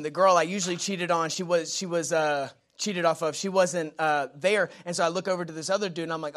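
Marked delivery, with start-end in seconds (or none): none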